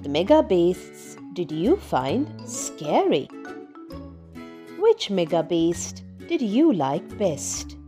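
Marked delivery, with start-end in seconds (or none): none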